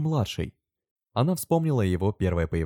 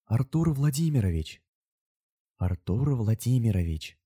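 hushed wonder, drawn out the second time; reverent, slowing repetition; reverent incantation; 4 semitones higher than usual. A narrator's reading. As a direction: repeat it with awe, like a spell. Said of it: "повторила она с благоговением, как заклинание".

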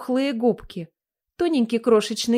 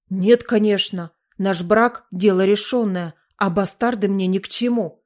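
plain reading voice

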